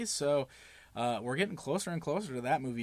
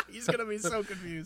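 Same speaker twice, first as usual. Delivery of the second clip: laughing; 7 semitones higher, 4 dB quieter than usual. Real, not acted. Laughing while the call rings.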